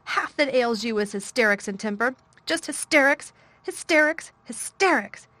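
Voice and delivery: loud, high repeated scolding; girl scolding boy, stamping each word. A narrator's reading. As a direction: hammer each hysterics. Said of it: "she stamped each time she said it".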